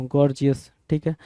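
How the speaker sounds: ordinary talk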